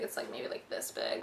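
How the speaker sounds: breathy confiding aside; whispered aside. Real, not acted. Describing her birthmark, whispered to viewers. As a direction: whisper the size under your breath.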